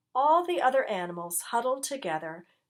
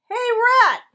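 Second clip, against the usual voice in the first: loud, much higher bark; 13 dB louder than usual. A narrator's reading. Dog calling across the junkyard, barking. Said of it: "barked Dog".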